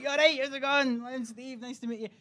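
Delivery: retold breezy introduction; cheery greeting. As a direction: greet new colleagues far too breezily. Cheery greeting on first work day.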